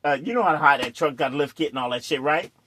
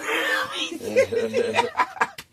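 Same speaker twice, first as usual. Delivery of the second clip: shouted laugh. Real, not acted. Laughing hard telling friends about the banged heads. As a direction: roar at the memory.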